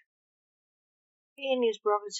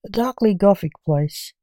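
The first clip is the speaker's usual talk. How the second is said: dramatic intro; 12 dB louder than usual. Acted. Podcast intro.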